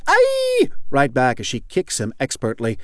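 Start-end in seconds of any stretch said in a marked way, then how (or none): 0.1-0.7 very high, loud fighting shriek; tough nun's yell. A narrator's reading. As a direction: yell it right back, piercingly high. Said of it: "The tough-looking nun yells".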